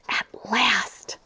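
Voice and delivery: whispered sigh of relief; relieved whisper. Acted. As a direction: whisper it as if finally resting. The dog finally naps.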